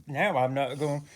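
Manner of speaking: loud, high huffy storytelling; half-pouting, loudly defending her story; indignant. Real, not acted. Offended teller loudly telling the rest to her friend. Indignant after being laughed at.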